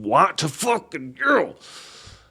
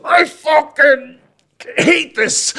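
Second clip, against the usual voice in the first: very high, loud, drawn-out mock shout; comically restrained rage; 6 dB louder, much slower than usual. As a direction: strain through a comic outburst, half laughing. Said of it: "restrained myself in probably the most comical way possible".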